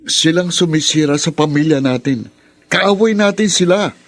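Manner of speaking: building to a loud, high shout; angry outburst. Acted. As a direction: shout that they are the enemy. Angry quarrel about the family.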